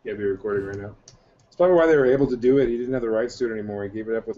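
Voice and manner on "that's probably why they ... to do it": louder, quick burst of a joke; eager loud quip; loud fast aside. Real, not acted. Excited overlapping joke.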